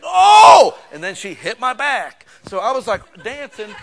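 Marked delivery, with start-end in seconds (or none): 0.0-0.8 sudden yelp, much higher and louder; yelp of pain. Retold or imitated. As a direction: yelp as the spank lands.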